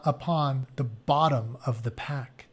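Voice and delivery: ordinary delivery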